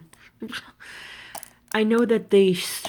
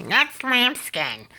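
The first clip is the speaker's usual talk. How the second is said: higher, very slow snooty put-on voice; mock salesman yell; 5 semitones higher, much slower than usual. Mocking the salesman yelling at her about lambskin. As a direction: stretch it out like a haughty clerk. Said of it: "yelling at me".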